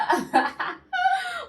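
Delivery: real high laugh, loud; high burst of laughter. Real, not acted. Remembering her friend calling her a liar, laughing out loud.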